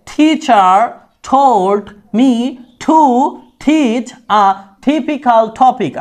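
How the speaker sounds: "টিচার told" with each T puffed like ঠ; word by word, every T in "teach a typical topic" aspirated as ঠ. Shown as a demonstration. Teacher modelling British T, slow aspirated words. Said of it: "ঠয় শিকারে টি".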